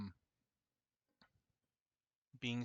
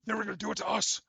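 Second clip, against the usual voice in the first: high, loud, distorted otherworldly voice; high weird voice; 9 semitones higher, 9 dB louder, much faster than usual. Acted. Shadowed figure as Aphrodite justifying the killing, high weird voice. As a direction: throw it up high and loud, eerily strained. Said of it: "like, high and low pitch, this weird voice".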